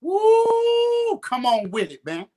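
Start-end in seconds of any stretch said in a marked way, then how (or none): 0.0-1.2 high joyful whoop; excited whoop. Real, not acted. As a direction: cheer it out loud. Celebrating the sister's earnings.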